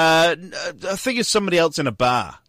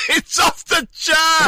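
laughing shout; 13 semitones higher, slower than usual. Real, not acted. Laughing exaggerated agreement.

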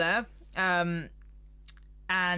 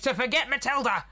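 skeleton voice; 6 semitones higher than usual. Retold or imitated. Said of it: "the skeleton's like".

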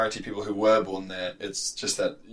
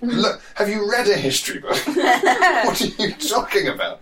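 much higher, louder, laughing incredulous tirade; exasperated rant; 8 semitones higher, 3 dB louder than usual. Retold or imitated. To passport refusers, an incredulous laughing rant. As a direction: build to laughing disbelief. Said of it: "And you go".

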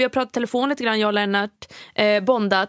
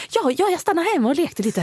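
higher, playful voice of an old man playing along; 6 semitones higher than usual. Lennart plays along with the teasing about his toys, higher and playful. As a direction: answer like a child caught playing. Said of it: "Han bara".

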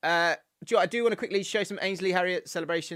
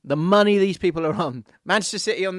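louder indignant repeat; 4 semitones higher, 8 dB louder than usual. Real, not acted. Disgust at footballers' wages.

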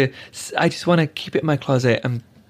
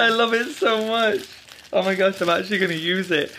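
thrilled, pitched-up gasp of delight; delighted opening a present; 5 semitones higher than usual. Real, not acted. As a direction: squeal over an unexpected gift. Opening a gift on air.

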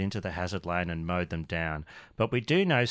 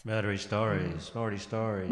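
echo effect; 3 semitones lower, 5 dB quieter than usual. Acted. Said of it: "turn that effect off".

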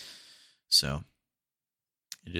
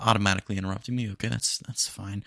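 ordinary talk, nothing marked

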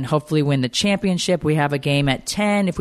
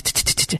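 pattering feet; 3 dB quieter than usual. Retold or imitated. Imitating little feet running.

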